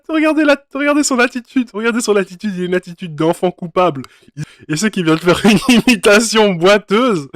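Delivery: high, loud laughing. Real, not acted. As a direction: laugh through it. Laughing at a bad impression.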